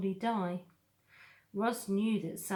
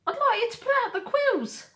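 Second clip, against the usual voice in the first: high, loud, whiny fox; 10 dB louder, faster than usual. Acted.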